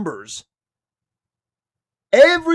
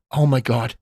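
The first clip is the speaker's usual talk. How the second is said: much lower, grumbling imitation; mock whispered griping; 8 semitones lower than usual. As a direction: imitate a churchgoer complaining under his breath. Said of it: "He's whispering to his wife".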